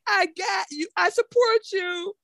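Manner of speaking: high, breathy mock reassurance; mock support. Friend silently cheering on her father's choice. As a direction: mouth it across the room.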